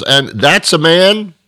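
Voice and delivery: normal talking voice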